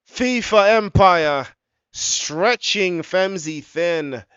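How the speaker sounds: high, loud, slowed call; excited commentary. Real, not acted. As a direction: ride the goal high, stretch it out. After the goal call.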